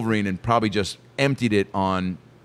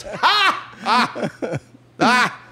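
mock laugh; 12 semitones higher, much slower than usual. Imitating judge's laugh.

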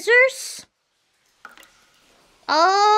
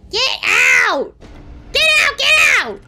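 loud shouts, building higher; acted angry shout; 5 semitones higher, 4 dB louder than usual. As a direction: yell it as an order to leave, escalating. Voices Lizette ordering the player out.

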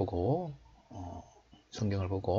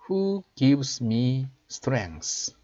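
slow, raised teaching voice; slow model reading; 4 semitones higher, 3 dB louder, much slower than usual. Shown as a demonstration. Teacher showing learners the English verse, slow model reading. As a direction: read it out slowly for learners to copy.

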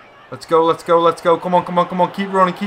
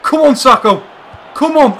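high, loud cries of come on; repeated frantic shouts; loud cheering; 10 semitones higher, 7 dB louder than usual. Real, not acted. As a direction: yell at the screen willing the goal. Cheering a chance in the match.

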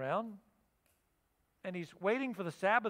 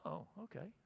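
meek acceptance; 2 semitones lower, 15 dB quieter than usual. Retold or imitated. Man accepts money.

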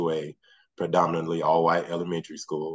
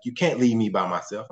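mock-childish protest, pitched higher; imitating whiny son; 6 semitones higher, 3 dB louder than usual. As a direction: play the kid who panics at being left behind. Son protests being left alone.

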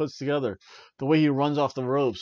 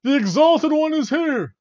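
high, slow, loud cult announcement; ominous promo voice; 13 semitones higher, 9 dB louder than usual. Retold or imitated. Mocking the Dark Order promo, grand and ominous. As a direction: proclaim like a cult herald. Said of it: "they give the promo".

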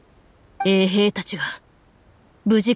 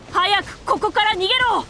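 much higher, frantic warning cry; panicked shout; 14 semitones higher than usual. Acted. Wounded guards screaming a warning to flee. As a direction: scream at them to run. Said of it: "そして彼らは叫んだ".

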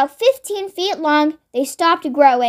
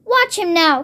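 ordinary delivery

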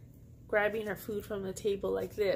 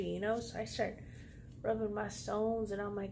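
ordinary talk, nothing marked

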